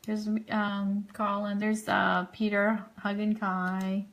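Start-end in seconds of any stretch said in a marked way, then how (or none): none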